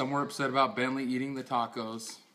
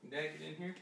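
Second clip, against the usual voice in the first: ordinary talk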